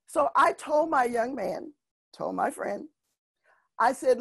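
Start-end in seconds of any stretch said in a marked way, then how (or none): none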